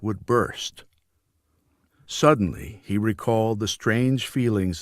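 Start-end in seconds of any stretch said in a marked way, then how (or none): none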